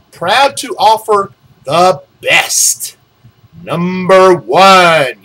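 slow, loud, every word leaned on hard; emphasized ad slogan. Shown as a demonstration. Reading the mailer aloud, punching the slogan slowly. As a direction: sell each word like a billboard. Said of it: "they want to emphasize".

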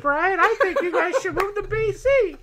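high, playful penguin voice. Pretend. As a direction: coax them like a cheeky penguin. Voicing the penguin's persuasion.

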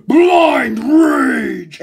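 long, loud, drawn-out yell; raging shout. Shown as a demonstration. Reviewer announcing how Shinji destroyed the monster, raging shout. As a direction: stretch each word into a big roar. Exclaims the rage dramatically.

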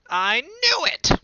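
high, loud, slow triumphant shout; high loud triumphant child cry. A narrator's reading. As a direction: shout it like you caught him. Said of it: "cried Dot".

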